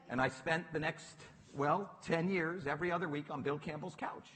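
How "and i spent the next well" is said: usual speaking voice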